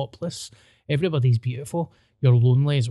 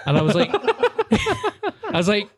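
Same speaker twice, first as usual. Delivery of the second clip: laughing; 13 semitones higher, slower than usual. Real, not acted. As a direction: voice high with laughter, slowly. Laughing through the story.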